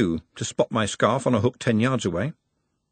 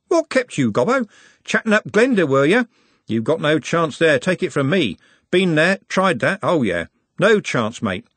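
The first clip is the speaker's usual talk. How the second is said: higher, louder street-lad banter; cocky Trev, teasing; 7 semitones higher, 4 dB louder than usual. A narrator's reading. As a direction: rib your mate like a cheeky know-all.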